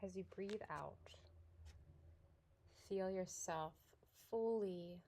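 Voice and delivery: soft, unhurried yoga nidra guidance with long pauses; guided meditation. Real, not acted.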